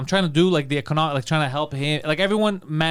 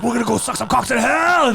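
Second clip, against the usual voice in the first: high, eager, giddy demon voice; excited demons; 6 semitones higher, 4 dB louder than usual. Pretend. Voicing dumb demons off to hell, giddy and higher. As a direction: play the dumb demons thrilled for vacation. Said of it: "They're all, like, excited".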